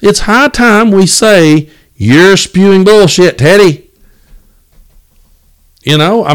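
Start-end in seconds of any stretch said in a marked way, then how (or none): 1.9-3.9 higher, slow and deliberate call-out; calling out bull. Pretend. To Uncle Ted at dinner, a slow, higher call-out. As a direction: spell it out slowly to the uncle's face.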